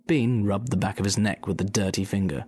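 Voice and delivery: usual speaking voice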